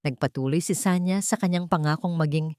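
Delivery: ordinary delivery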